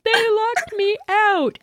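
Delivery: high, slow tearful whine; whiny complaint. Retold or imitated. Crying girl telling the boys, high slow whine. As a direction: plead like a locked-out kid. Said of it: "she's like".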